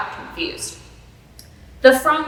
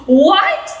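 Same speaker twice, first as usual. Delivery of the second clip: loud, high-pitched startled shout; shocked shout; 12 semitones higher, 11 dB louder than usual. A narrator's reading. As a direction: shout it in disbelief, pitch jumping up. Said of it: "shouted Doctor Horton".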